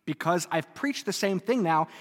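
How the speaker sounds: ordinary talk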